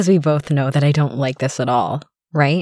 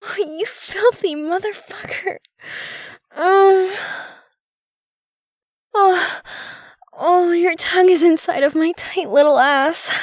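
very high, moaning disbelief; shocked gasping; 10 semitones higher than usual. Acted. Woman reacts to her partner, shocked and breathless. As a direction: gasp the words out in a high voice.

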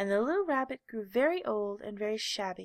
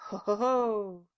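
mock laugh; 6 dB louder, slower than usual. A narrator's reading. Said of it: "said the furry rabbit".

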